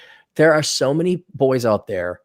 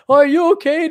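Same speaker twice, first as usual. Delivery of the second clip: high-pitched mock concern; hammed-up gullible sweetheart; concerned nice guy; 12 semitones higher, 5 dB louder than usual. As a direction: fuss in exaggerated sympathy. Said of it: "Like you're a nice guy".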